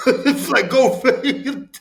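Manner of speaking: high laugh through the words. Real, not acted. Amused at becoming an Airbnb host, laughing. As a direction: let the laugh carry "go figure". Laughing at his own irony.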